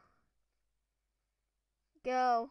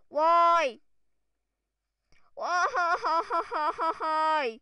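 anguished shout; 5 semitones higher, 10 dB louder than usual. Real, not acted. Failed the last jump.

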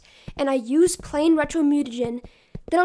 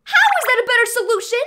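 exasperated; 7 semitones higher, 4 dB louder, faster than usual. Acted. April arguing with stubborn Donnie.

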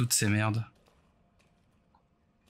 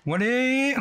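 high, loud cry of urging; excited yell; 12 semitones higher, 4 dB louder than usual. Real, not acted. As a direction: shout it out in a rush. Frantic shooting at zombie.

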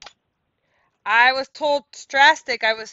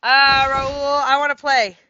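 louder, raised call across to a player; 3 semitones higher, 9 dB louder than usual. Real, not acted. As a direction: call out that you want to play. Calling out to a player.

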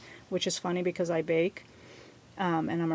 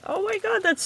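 excited squeal; 12 semitones higher, 5 dB louder, faster than usual. Real, not acted. High squeal.

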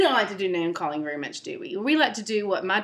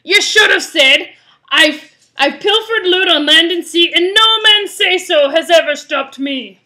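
loud, high, boastful pirate roar; pirate shout; 8 semitones higher, 14 dB louder than usual. Acted. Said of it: "Pete shouted".

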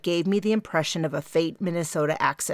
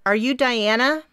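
bright raised call through the glass door; 7 semitones higher, 5 dB louder than usual. A narrator's reading. Girl calls to Diana through the glass, bright and raised. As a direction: call out her name eagerly. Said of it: "She called out".

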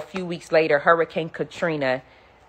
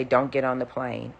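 soft, low inner voice; a hushed warning inside her head; softer mysterious voice; quiet warning voice; 3 semitones lower, 6 dB quieter than usual. Retold or imitated. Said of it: "I heard a voice say".

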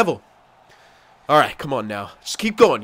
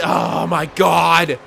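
exasperated cry; much slower than usual. Real, not acted. Player fed up after being kept out, exasperated cry. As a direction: drag the words out in frustration.

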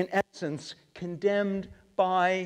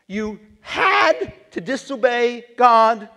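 louder, slow, incredulous challenge; Samuel yelling; 3 semitones higher, 6 dB louder, slower than usual. Acted. Samuel yelling at Saul in slow disbelief. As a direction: throw the excuse back at him. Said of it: "I believe he yelled it".

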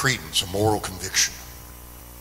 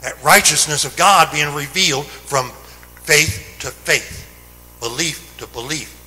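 louder, higher, urgent pulpit preaching; fervent Sunday preaching, ringing out; preaching at full force; 5 semitones higher, 6 dB louder than usual. Acted. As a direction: drive the message home to the congregation.

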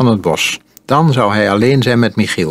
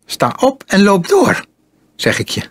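voice cracking; 10 semitones higher than usual. A narrator's reading. Boy with pistol ordering his captive on, voice cracking. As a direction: order him up, voice jumping high. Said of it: "Michiels stem sloeg vervaarlijk over".

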